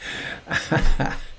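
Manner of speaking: breathy, unvoiced laugh; real laughter. Real, not acted. Teacher after singing with the group, soft laughter. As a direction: laugh softly after the song.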